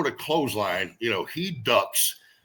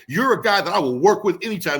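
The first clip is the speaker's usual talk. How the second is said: a wrestler voicing Larry's backstage praise, higher and proud; imitating the promoter; 7 semitones higher, 5 dB louder than usual. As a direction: voice the old promoter handing out compliments. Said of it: "He said".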